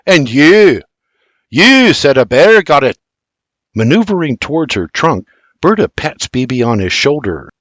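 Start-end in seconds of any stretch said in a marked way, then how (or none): 0.0-3.0 loud accusing bark. A narrator's reading. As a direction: accuse William sharply.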